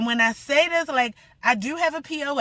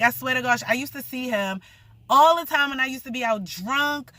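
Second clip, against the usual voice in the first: normal talking voice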